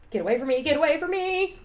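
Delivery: rising scared yell; cornered, yelling in fear; panicked yelling. Retold or imitated. Cornered by chainsaw clown.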